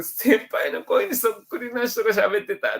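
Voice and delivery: slow, high, lightly voiced younger student; imitating a junior. Junior tells his senior about a lookalike voice, slow and high. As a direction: report it like an excited kouhai. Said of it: "大学の後輩とかと一緒に飲んだったりしてて".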